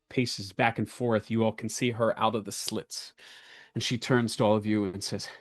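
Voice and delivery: slow, measured scene-setting narration; game master narration. Acted. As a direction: set the scene for the players.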